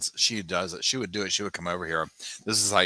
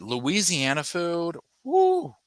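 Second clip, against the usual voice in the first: excited whoop; 9 semitones higher, slower than usual. Real, not acted. Excited cheer for home food.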